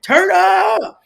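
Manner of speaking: high, loud laughing party cry; partying shout. Retold or imitated. People ignoring God, shouting a laughing party cheer. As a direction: whoop it up with a laugh. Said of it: "We over there like".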